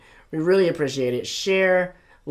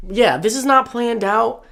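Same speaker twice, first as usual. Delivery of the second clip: raspy voice; 6 semitones higher, slower than usual. Real, not acted. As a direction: voice gone a bit raspy. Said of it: "Your voice got a little raspy".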